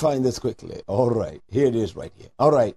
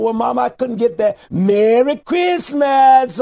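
mocking quote; 7 semitones higher, 6 dB louder, slower than usual. Mocking grown children calling their mother.

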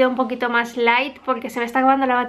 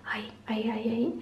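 whispering; 11 dB quieter, faster than usual. Real, not acted. Pointing at acne treatment.

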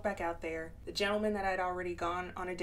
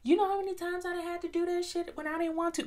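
high, fast protest in another person's voice; mock objecting listener; 9 semitones higher, faster than usual. Listener admitting unwanted sex to the speaker, mock protest. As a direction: imitate someone pushing back, pitched way up. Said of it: "you telling me".

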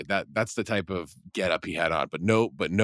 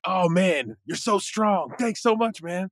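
high, gushing imitated thanks; a pitched-up grateful stranger voice; 12 semitones higher, slower than usual. Stranger gushing thanks to the jogger, pitched high.

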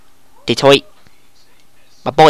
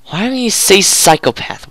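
ordinary delivery

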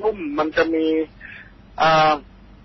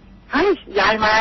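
higher, slow, eager exclaim; excited child; 6 semitones higher, slower than usual. Retold or imitated. Young boy about to greet his grandmother, eager and high. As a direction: blurt it out like a happy kid spotting granny.

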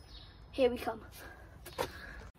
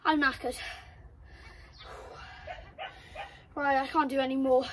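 out of breath. Real, not acted. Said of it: "Why am I so out of breath?".

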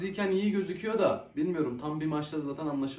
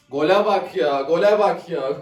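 shouted twice at the screen; loud disbelief; 9 dB louder than usual. Real, not acted. Fan yelling at a goal conceded on screen, loud.

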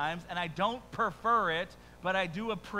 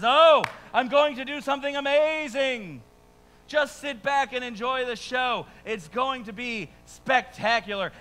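a hammed-up promise, louder and higher; showy excitement; 7 semitones higher, 8 dB louder than usual. God promising the frustrated prophet a grand show, hammed-up and excited. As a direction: sell it like a showman. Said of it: "he says".

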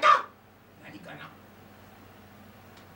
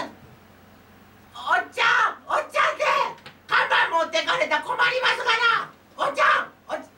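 desperate fox cub; faster than usual. Acted. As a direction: chase after him, high and pleading. Fox cub chases the man. (看板を取られた子狐が甲高く叫ぶ)

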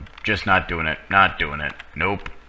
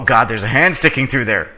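usual speaking voice

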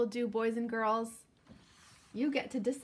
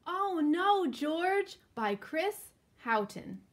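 put-on slow, lively storybook reading for kids; reading to children; 2 semitones higher, 5 dB louder, slower than usual. A narrator's reading. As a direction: read it out like story time for little ones. Reading picture book to children.

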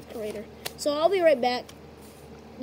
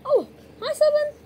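much higher, louder squeaky toy greeting; bad voice acting; 14 semitones higher, 8 dB louder than usual. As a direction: greet Seven as a toy, high and loud. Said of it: "I'm a very bad voice actor".

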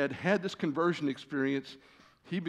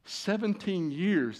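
Seventeen years drawn out slowly and higher; emphatic; 6 semitones higher, much slower than usual. Real, not acted. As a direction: lean hard on Seventeen, slow it right down. Preacher stresses long exile.

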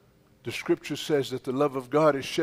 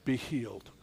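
normal talking voice

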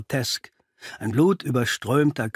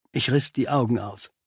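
plain reading voice

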